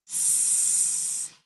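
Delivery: "S" as a long unvoiced hiss, tongue behind teeth. Shown as a demonstration. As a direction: hiss "S" out steady. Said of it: "our tongue stays behind our teeth".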